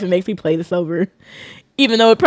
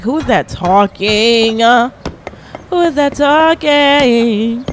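slow puzzled question; much slower than usual. Retold or imitated. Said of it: "I was like".

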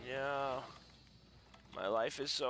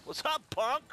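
bully taunt; 12 semitones higher, 5 dB louder than usual. Pretend.

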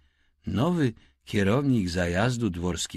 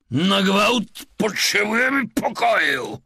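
loud, high, desperate shout for a room; urgent shout; 11 semitones higher, 8 dB louder than usual. A narrator's reading. Man bursting in with partner shouts for a room. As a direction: yell it at the clerk, no patience. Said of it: "zawołał mężczyzna".

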